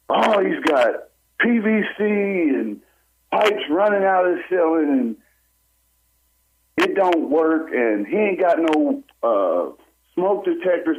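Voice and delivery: pitched well up, whiny mock complaint; high mocking parody of a fussy inspector. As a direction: sneer out the inspector's nitpicks.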